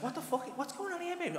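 high, fairly soft puzzled voice; imitated bafflement. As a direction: mimic a confused partner, pitch climbing. Voicing partner baffled by nested boxes.

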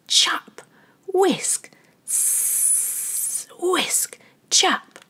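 crisp whispered chop and whisk; kitchen sound effects. A narrator's reading. Narrator sounding out the busy kitchen, playful. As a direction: make the kitchen sounds rhythmically.

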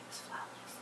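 breathy secret whisper; whispered aside. Real, not acted. Whispering an aside to viewers about Lupita's skin. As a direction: lean in and whisper it.

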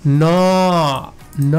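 ন held long as "nawww"; stretched open vowel. Shown as a demonstration. Teacher shows the hidden vowel, long open-vowel syllable. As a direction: draw ন out on an open aw. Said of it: "যখন উচ্চারণ করব দেখেন".

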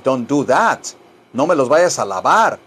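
normal talking voice

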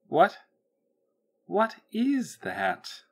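high, drawn-out disbelief; startled. A narrator's reading. The voice reacting to an incoming signal, startled.

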